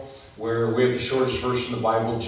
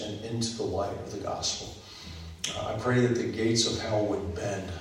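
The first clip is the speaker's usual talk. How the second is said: quiet, earnest pastoral prayer, fading softer at phrase ends; 5 dB quieter than usual. Acted. Pastor leads opening prayer.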